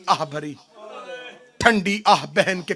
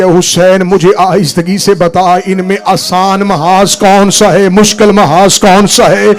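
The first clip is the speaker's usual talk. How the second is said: tearful plea; 9 dB louder than usual. Acted. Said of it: "Zainab रो के कहती है".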